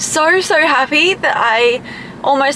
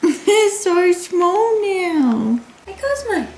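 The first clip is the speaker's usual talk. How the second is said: high, drawn-out pet talk; cooing at pets; 6 semitones higher, much slower than usual. Real, not acted. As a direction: coo slowly to a little dog in a high voice. Cooing at freshly groomed dogs.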